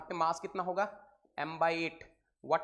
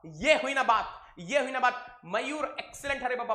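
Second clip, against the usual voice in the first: upbeat praise; 3 semitones higher, 6 dB louder than usual. Real, not acted. Praising students one by one.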